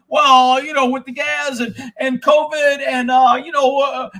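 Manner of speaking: a hint of a stammering service guy; lightly voiced dealer fumbling for excuses; mocking the dealer.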